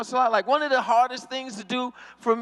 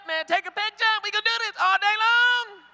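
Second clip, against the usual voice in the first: giving in gleefully; 9 semitones higher than usual. Retold or imitated. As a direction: pitch jumps high, hyped-up giving in. Gives in to the fans.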